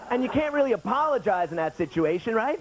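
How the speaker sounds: ordinary talk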